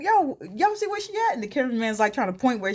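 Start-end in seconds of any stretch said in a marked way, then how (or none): 0.0-1.3 high-pitched acted searching; mock urgent call. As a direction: call to the crew, imitating someone frantic. Acting Kendrick calling to the crew.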